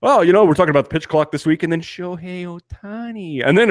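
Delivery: quick broadcaster patter that slows to a hush on Shohei Ohtani; mock broadcaster slowdown. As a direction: parody host slowing down reverently on the name. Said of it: "when they say his name, it slows down".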